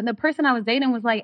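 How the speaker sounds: ordinary delivery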